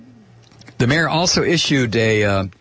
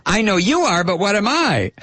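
put-on high, childish sing-song taunt; whiny taunt; 9 semitones higher than usual. Reading a tip for mocking hecklers, in a whiny taunt. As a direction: tease them in a high, whiny voice. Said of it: "repeat it back to them in a whiny voice".

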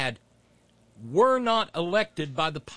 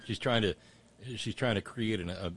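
ordinary talk, nothing marked

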